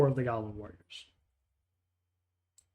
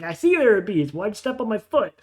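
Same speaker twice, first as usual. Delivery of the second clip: louder and higher, fed up; exasperated goblin; 6 semitones higher, 6 dB louder, faster than usual. Acted. Goblin repeating his complaint to the neighbour, exasperated. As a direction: push back, clearly annoyed. Goblins bicker after foot stepped.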